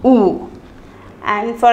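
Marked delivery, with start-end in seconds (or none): 0.0-0.5 pure rounded oo; German U. Shown as a demonstration. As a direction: repeat U as a long oo. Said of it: "pronounced as U".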